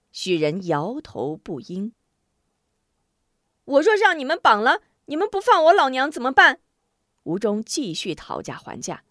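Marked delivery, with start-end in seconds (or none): 3.6-6.6 quick, loud, high-pitched retort; raised voice, haggling hard; defiant challenge. A narrator's reading. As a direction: fire the question back at the captor. Hero haggles loudly with captor.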